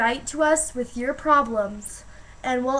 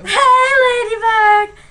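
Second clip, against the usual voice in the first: excited call; 9 semitones higher, 11 dB louder than usual. Real, not acted. Calling out to a ladybug.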